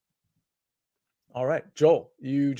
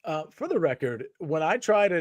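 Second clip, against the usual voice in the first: ordinary talk, nothing marked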